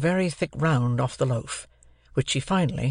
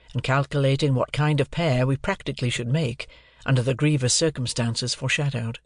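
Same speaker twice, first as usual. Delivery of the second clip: plain reading voice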